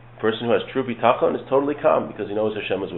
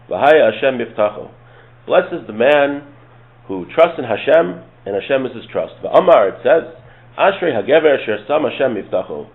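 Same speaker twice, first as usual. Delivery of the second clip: scripture recitation; 3 semitones higher, 5 dB louder than usual. Acted. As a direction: declaim each verse and its translation. Recites and translates scripture verses.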